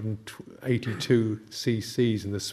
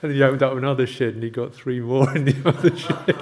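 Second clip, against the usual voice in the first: louder, laughing as he tells it; can't hold back the laughter over the sheds; laughing through words; 3 semitones higher, 8 dB louder than usual. Real, not acted. Laughing at the biker story.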